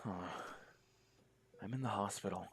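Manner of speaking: usual speaking voice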